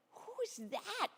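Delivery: high, puzzled question; wondering aloud. Acted. Women at the tomb asking, high and puzzled. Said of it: "they wondered".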